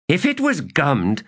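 high-pitched sharp comeback; retort. Acted. Kisware answers a charge of cheating, retorting. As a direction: throw the accusation back. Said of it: "Kisware retorted".

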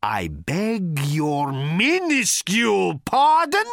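slow, rising, incredulous roar; loud, drawn-out, climbing in pitch. A narrator's reading. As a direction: roar in offended disbelief. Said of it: "roared Thor".

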